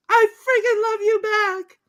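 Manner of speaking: very high, squealed reply; imitated high-pitched lovestruck woman; woman in love. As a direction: squeal it back in mock passion. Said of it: "And she says".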